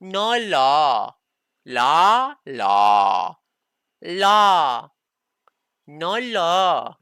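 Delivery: loud mock Chinese accent, "No law" as "La". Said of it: "say it in a Chinese accent".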